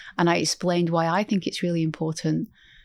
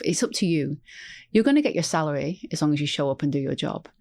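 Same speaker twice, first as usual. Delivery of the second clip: ordinary delivery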